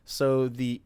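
normal talking voice

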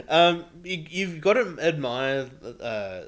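ordinary talk, nothing marked

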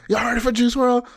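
high, loud crowd call; imitating hype man. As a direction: call out to a concert crowd, mockingly. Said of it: "the D J or the hype man".

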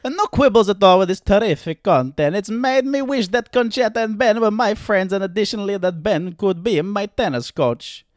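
high, loud mock nonna; old nonna. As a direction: attempt an elderly Italian grandmother. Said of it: "Can you do an old nonna".

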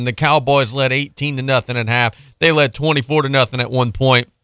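normal talking voice

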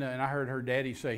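ordinary talk, nothing marked

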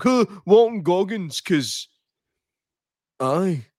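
bully voice. Retold or imitated. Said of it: "you just be like".

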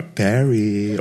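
a mother's changed call of her son's name; mother calling, another way. Retold or imitated. Mother calls her son Barry in another tone. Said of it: "like when your mother calls you".

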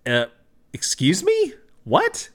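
very high, questioning captain voice; puzzled, high-voiced disbelief; incredulous captain. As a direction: react taken aback, pitch leaping up. Captain's startled reply in story.

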